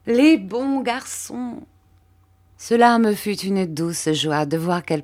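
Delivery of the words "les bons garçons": slow, drawn-out tender praise; fond refrain. A narrator's reading. Mère Barberin fondly repeats her praise of both boys. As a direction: linger lovingly on each word.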